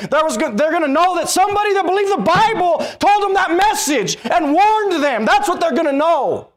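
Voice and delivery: fiery sermon peak; preaching at full force. Acted. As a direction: build to a shouting climax. Preaching climax.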